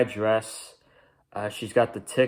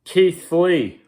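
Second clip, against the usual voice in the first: a loud, higher call of the name, like a ring announcer; 7 semitones higher, 8 dB louder than usual. Acted. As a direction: belt out the name as if introducing him. Announcing the next segment.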